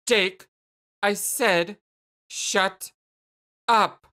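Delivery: halting angry order, building on the last word; mounting anger. Acted. Jane cutting off Jake's protest, mounting anger. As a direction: force out each word separately. Orders him silent, losing patience.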